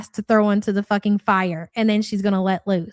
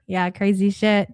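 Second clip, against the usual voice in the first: ordinary talk, nothing marked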